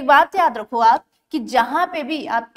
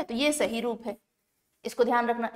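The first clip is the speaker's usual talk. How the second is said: ordinary delivery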